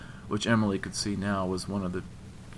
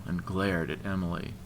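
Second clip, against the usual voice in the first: usual speaking voice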